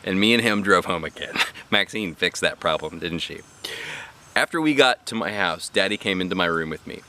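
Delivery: plain reading voice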